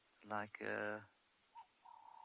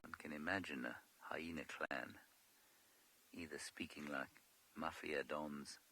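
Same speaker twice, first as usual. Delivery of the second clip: ordinary talk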